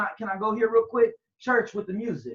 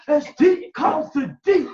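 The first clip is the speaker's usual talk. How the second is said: slow, louder, higher, hammed-up preacher drawl; mock preacher; 5 semitones higher, 6 dB louder, slower than usual. Mocking how people preach this verse, hammed-up preacher. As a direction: mimic the overblown pulpit style. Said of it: "how they talk".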